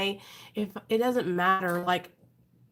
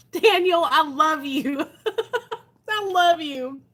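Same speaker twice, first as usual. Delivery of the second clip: laughing; 6 semitones higher, 5 dB louder, slower than usual. Real, not acted. Husband makes her laugh.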